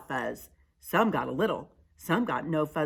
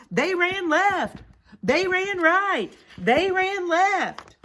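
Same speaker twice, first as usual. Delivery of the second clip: high, slow, loud beats; excited narration; 7 dB louder, slower than usual. A narrator's reading. Narrator following prairie dogs running to catch him, excited. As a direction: build suspense with each dash.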